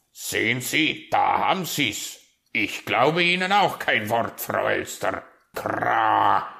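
loud raven voice ending in a caw; disbelieving raven. Acted. Raven refusing to believe Frau Elster, scornful. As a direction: declare it loudly, then croak.

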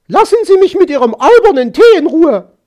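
fast, high, loud yell; angry scream. A narrator's reading. Magpie fed up with the fox's silly tea, screaming. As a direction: scream it out in one exasperated rush. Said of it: "schrie sie".

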